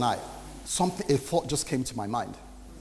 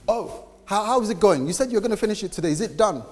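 eager boss; 8 semitones higher, 4 dB louder than usual. Retold or imitated. Boss checks on the deadline.

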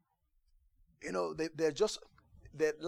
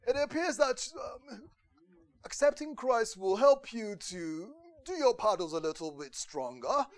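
higher, louder mock upper-class British accent; mock posh British accent, much higher and louder; 7 semitones higher, 7 dB louder than usual. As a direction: ham up a proper English gentleman. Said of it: "If you're British, like the other prince".

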